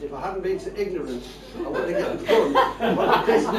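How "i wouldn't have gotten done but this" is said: laughing. Real, not acted. Laughing at own punchline.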